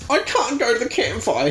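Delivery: high whiny sob; sobbing. Acted. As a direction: whimper it through tears. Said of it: "he sobs".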